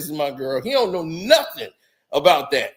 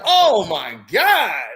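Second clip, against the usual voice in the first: dragged-out mock shock; 5 dB louder, much slower than usual.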